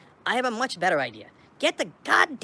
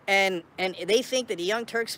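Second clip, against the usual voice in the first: ordinary delivery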